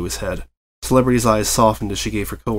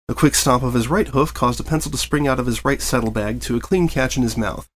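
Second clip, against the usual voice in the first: plain reading voice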